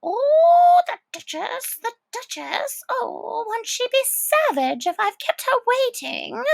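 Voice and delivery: high, anxious fretting rabbit; the hurried Rabbit fussing aloud. Acted. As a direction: flutter in a panic over the Duchess. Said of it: "muttering to himself".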